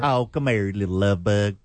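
mock Southern auntie, coaxing; light Deep South endearment; Southern accent. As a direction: call the kid over in a Southern lilt. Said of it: "I really like the Deep South".

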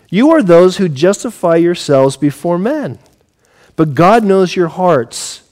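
ordinary talk

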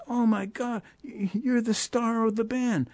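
very high, wailing dismay; mock panic. Mez hears his star can't go, high-pitched panic. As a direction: imitate a man losing his calm, pitch way up. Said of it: "Mez, calm down".

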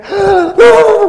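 loud high gasp of fright; big hammed-up frightened intake; terrified gasp. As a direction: gasp as if scared to death. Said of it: "muertos de miedo".

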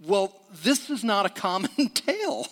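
a lightly voiced hearer leaning in, half laughing; mock wonder at an odd story, breaking into a laugh; intrigued listener. Pastor voicing a listener drawn into the story, lightly intrigued. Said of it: "to lean in and to say".

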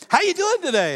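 high, loud, peppy question; excited store worker. Retold or imitated. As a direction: imitate an overeager employee greeting a customer. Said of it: "they were excited about what they were doing".

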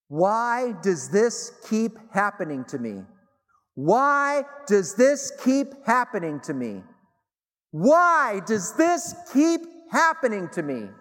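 a friend's repeated wail, growing from pleading to near-shout; building anguished cry. Retold or imitated. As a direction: let the cry swell, slow and desperate, until it nearly breaks. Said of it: "complete sobbing".

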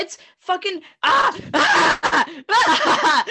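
loud strangled ah of frustration, pitch jumping up; loud exasperated groan; exasperated groaning cry. Real, not acted. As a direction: let out a pained, fed-up wail. Frustrated about bad accent.

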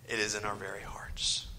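hushed whisper to drive the point home; dramatic whisper. Real, not acted. Preacher to congregation, a hushed whisper. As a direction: let the line fall to a whisper.

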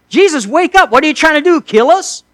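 high, loud, whiny panic at Jesus; mock-snippy cry to a sleeping master; snarky disciples. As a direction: needle him like frightened sailors. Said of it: "they do it in an almost snarky way".

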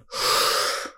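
imitated long blown-out breath; big deep exhale. Showing how the man took his deep breath, big exhale. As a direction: blow out a demonstrative deep breath. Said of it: "he went like this, both hands on legs and went".

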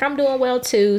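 high, polite service reply; professional customer service voice. Shown as a demonstration. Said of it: "like, a professional customer service voice".

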